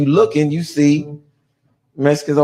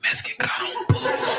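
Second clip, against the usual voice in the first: hushed, whispered warning; furtive whisper; 6 dB quieter than usual. Retold or imitated. Inmate passing word along the yard, furtive whisper. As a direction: pass it on under your breath.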